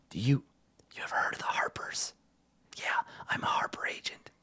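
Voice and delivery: hushed conspiratorial whisper. Acted. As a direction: whisper the bribe so no one hears. Prisoner whispering a secret offer.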